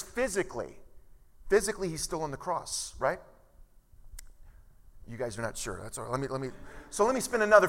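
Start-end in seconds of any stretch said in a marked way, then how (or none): none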